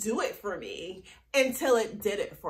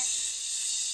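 a breathy shush; playful shush; 7 dB quieter than usual. Real, not acted. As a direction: hush the little director. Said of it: "Directors don't interrupt".

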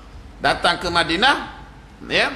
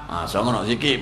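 ordinary delivery

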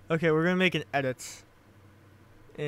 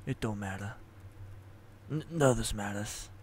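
low, much softer, dead mutter; 6 semitones lower, 9 dB quieter than usual. A narrator's reading. Carl watching from the bushes, muttering low and soft. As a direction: mumble it low and hopeless. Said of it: "Carl mutters".

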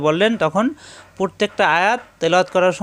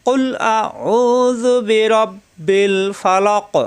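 slow, higher melodic tilawat with long vowels; correct recitation; 5 semitones higher, much slower than usual. Shown as a demonstration. Teacher modeling the correct recitation for students. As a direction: chant কুল আউযু বিরাব্বিল ফালাক slowly and correctly. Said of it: "আমরা কিন্তু অনেকেই এভাবে পড়ি".

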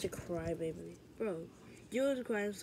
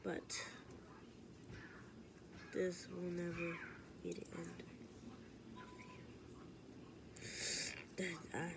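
menacing whisper; 3 semitones lower, 14 dB quieter than usual. Acted. Villain menacing quietly.

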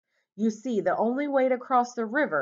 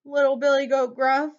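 high little goat voice answering up; high-pitched, loud meek reply; 8 semitones higher, 5 dB louder than usual. A narrator's reading. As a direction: pipe up as a small goat. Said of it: "said the goat".

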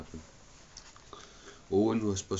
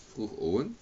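plain reading voice

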